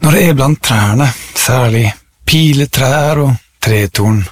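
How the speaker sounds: measured poem recital with long pauses; poetry reading. Acted. Reciting a favourite tree poem to listeners, poetry reading. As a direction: let each phrase of the poem settle.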